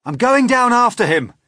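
high, loud determined shout; boy hero calling out loudly; urgent cry. A narrator's reading. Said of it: "cried James".

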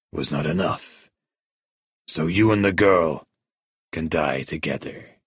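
controlled, unhurried menace; menacing crime boss. A narrator's reading. Villain's speech in narrated story.

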